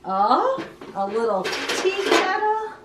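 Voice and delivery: ordinary delivery, nothing marked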